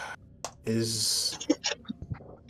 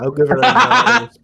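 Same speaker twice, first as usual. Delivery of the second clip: laughing; 9 semitones higher, 4 dB louder, slower than usual. Real, not acted. Laughing through words.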